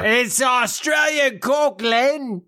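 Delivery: high, drawn-out Australian shout; Australian accent. Shown as a demonstration. Showing co-hosts an attempt at an accent, hammed-up Australian. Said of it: "That's my accent".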